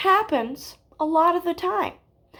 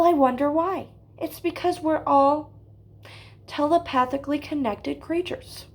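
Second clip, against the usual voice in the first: ordinary delivery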